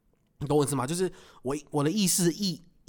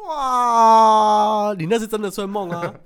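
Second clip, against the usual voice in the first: loud, high surprised cry; surprised outburst; 6 semitones higher, 7 dB louder, much slower than usual. Real, not acted. Reacting to his co-host's sex-dream question, loud surprise. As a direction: burst out in disbelief.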